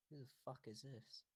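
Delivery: soft, discreet question into an ear; hushed aside. Acted. Yen leans into Yenarin's ear, hushed. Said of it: "Yen leans into Yenarin's ear and goes".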